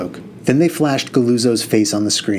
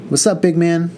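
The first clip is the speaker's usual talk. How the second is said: higher, bright greeting; girl voice; 7 semitones higher than usual. A narrator's reading. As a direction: greet him warmly. Barry greets narrator.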